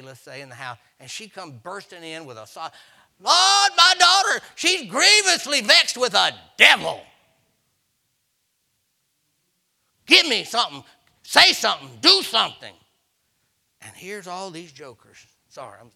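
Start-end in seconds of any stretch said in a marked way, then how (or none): 3.2-12.7 very high, loud pleading cry; desperate wailing plea. Retold or imitated. Mother bursting into dinner, crying out to the Lord for her daughter. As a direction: wail it out like a frantic mother.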